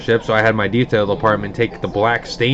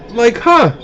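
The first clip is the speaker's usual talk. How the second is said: wry surprise; 10 semitones higher, 3 dB louder than usual. Real, not acted. Reacting to the lawsuit letter.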